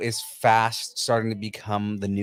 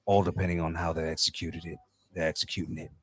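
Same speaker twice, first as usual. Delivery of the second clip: deep growl; 5 semitones lower, 7 dB quieter than usual. Retold or imitated. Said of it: "You have to do deep growl when he talks".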